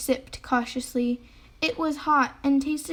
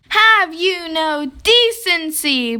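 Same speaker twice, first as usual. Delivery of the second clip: loud, slow outraged growl; 5 semitones higher, 7 dB louder, slower than usual. A narrator's reading. Bear rising up at the greedy mouse, loud growl. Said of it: "growled Bear".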